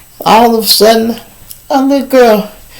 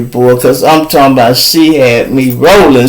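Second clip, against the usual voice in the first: usual speaking voice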